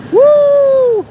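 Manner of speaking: loud high whoop; cheering whoop. Real, not acted. Plane spotter cheering a passing jet, loud whoop. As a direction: let out a big cheer.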